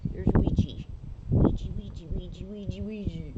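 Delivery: calling her dog's name loud and sing-song; loud pet call. Real, not acted.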